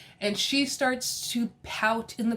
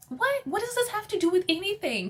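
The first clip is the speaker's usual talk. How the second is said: incredulous; 10 semitones higher than usual. Real, not acted. Outraged at Sutton's reaction.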